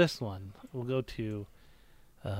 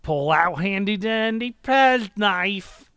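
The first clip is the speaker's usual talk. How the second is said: slow, higher, louder instruction narrator; NPC voice; 5 semitones higher, 5 dB louder, slower than usual. Acted. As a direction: read it like a hammy game tutorial. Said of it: "my N P C voices".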